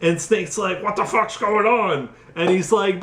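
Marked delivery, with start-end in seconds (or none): 0.8-2.1 higher, bewildered outcry; mock confusion. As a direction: play a baffled hero, pitched up. Voicing Snake's confusion in retelling.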